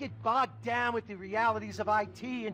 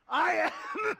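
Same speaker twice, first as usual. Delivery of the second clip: fake coughing fit. Dying villain coughing mid-speech.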